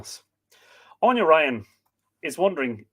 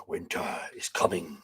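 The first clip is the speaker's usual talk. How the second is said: low, hushed and much softer, like a doom-laden prophecy; ominous catchphrase; 9 semitones lower, 8 dB quieter than usual. Pretend. Host echoes the famous line to viewers as a low, hushed warning. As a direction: drop into a grim, hushed warning.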